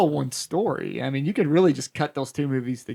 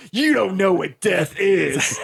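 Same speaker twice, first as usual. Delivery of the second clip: slow, grave mock growl with laughter breaking through; Loomis, dead serious; 3 semitones higher, 3 dB louder, much slower than usual. Doctor Loomis snapping at his neighbor, hammed-up grave and slow. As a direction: ham up a stern warning while cracking up. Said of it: "in, like, the most, like, serious way".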